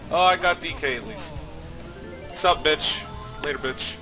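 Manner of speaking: ordinary talk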